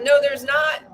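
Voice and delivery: retold shout. To the manager in the lobby, shouted denial. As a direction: shout it back at him. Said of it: "I was like".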